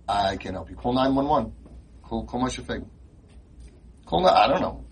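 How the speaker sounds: a helpless counselor giving up, voice dropping away; mock therapist washing his hands of it.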